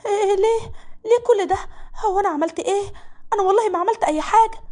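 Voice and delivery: high, tearful protest of innocence; frightened maid. Acted. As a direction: plead that you did nothing, close to crying. Malak pleads innocence, near tears. (صوت خادمة خايفة بتدافع عن نفسها وهي على وش عياط)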